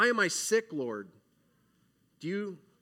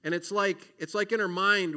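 ordinary talk

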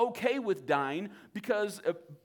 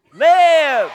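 Live shouted, much higher and full force; loud; 9 semitones higher, 21 dB louder than usual. Shown as a demonstration. Pastor to youth group, shouting Live. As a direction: bellow the word Live. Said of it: "So we say it loudly".

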